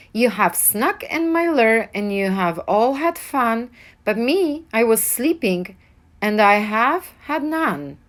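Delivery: loud complaining bear voice; whiny upset bear. A narrator's reading. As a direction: complain loudly like a hurt bear. Bear complaining, then whimpering.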